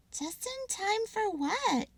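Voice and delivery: high, slow curious squirrel; sister squirrel. Acted. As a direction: ask it puzzled, little voice.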